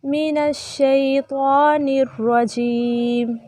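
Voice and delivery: slow, measured chant with long held vowels; Quranic recitation. Shown as a demonstration. Teacher recites for students to repeat, slow chant. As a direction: intone each phrase and stretch the endings.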